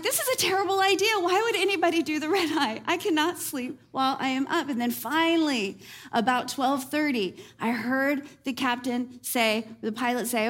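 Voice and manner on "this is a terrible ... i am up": higher, fast, exasperated complaining; exasperated rant. Retold or imitated. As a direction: rattle off the tired outrage. Exhausted on delayed red eye.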